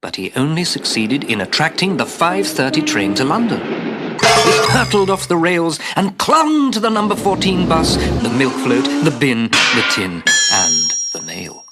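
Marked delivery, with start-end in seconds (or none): none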